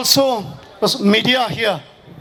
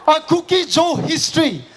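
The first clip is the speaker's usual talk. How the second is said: rally speech; 4 semitones higher than usual. Acted. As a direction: rouse the crowd, voice raised. Fiery speech to crowd at rally.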